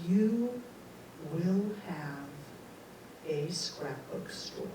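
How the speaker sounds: slow, hushed pronouncement from beyond; solemn voice from nowhere, falling away; big mysterious voice. Retold or imitated. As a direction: drop low and space the words out. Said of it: "this big voice goes".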